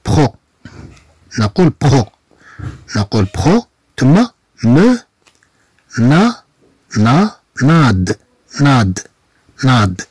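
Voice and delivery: pro and nade repeated as slow separate syllables; syllable drill. Shown as a demonstration. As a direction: drill each syllable slowly. (تدريب على المقاطع ببطء)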